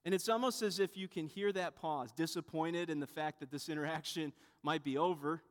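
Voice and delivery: normal talking voice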